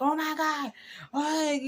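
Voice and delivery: high, loud "oh my God" as the husband; mock alarmed husband; husband's dismay. Husband hearing about the crash, mock alarm. Husband reacting to accident news.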